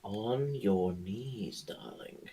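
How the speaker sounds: slow sultry whisper; whispered, slow and seductive; whispered command. Acted. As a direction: breathe it slowly like a seductress.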